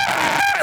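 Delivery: short, very high mock scream; hammed-up reporter scream; imitated scream. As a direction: let out a sudden shrill shriek. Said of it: "she just starts screaming".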